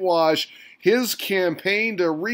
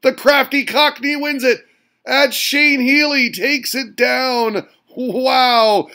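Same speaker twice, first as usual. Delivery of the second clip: higher, louder hype call, slowing on the name; excited winner call; 5 semitones higher, 5 dB louder than usual. Real, not acted. Host revealing the darts limerick winner, hyped and loud. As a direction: call the winner like a ringside announcer.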